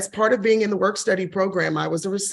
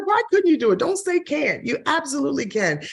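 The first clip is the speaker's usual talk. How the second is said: much higher, insistent retold pep talk; imitated mentor urging; 7 semitones higher than usual. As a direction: imitate a mentor refusing to accept doubt. Voices mentor's urging in retold exchange.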